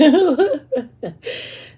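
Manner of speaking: loud, high burst of laughter; amused laugh. Real, not acted. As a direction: burst out laughing at your own story. Laughs at the quoted threat.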